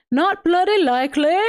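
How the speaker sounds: hammed-up imitation of a Cockney accent; mock Cockney accent. Quoting a Seinfeld line to viewers, mock Cockney accent. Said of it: "a Cockney accent".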